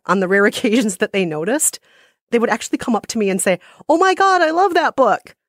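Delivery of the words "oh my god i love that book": high, gushing fan exclamation; gushing stranger. Retold or imitated. Stranger coming up to her about the book, gushing. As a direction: imitate an excited fan, pitched way up.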